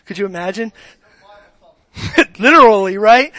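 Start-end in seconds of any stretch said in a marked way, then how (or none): 2.0-3.3 laughing. Real, not acted. Laughing at own joke.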